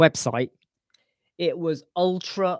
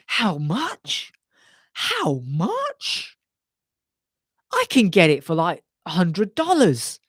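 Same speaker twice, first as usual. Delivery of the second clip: incredulous customer; 3 semitones higher, 3 dB louder, slower than usual. Retold or imitated. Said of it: "customers come to me and go".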